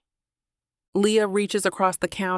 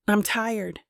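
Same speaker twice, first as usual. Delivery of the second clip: a touch of weariness; exhausted. A narrator's reading. Woman lying to end the talk, exhausted. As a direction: say it a little drained, as if worn out. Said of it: "my voice is exhausted enough".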